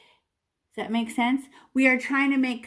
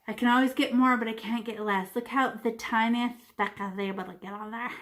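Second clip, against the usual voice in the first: usual speaking voice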